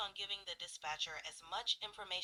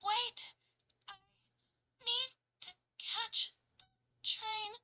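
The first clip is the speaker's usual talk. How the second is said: pausing, out of breath; 13 semitones higher, 5 dB louder than usual. Shown as a demonstration. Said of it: "a lot of pause between each word".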